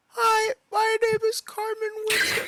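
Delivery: very high, squeaky meme voice; meme impersonation. Retold or imitated. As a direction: imitate a viral clip in a tiny high-pitched voice. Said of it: "The one I don't get is, like, the".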